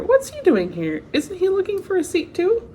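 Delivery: plain reading voice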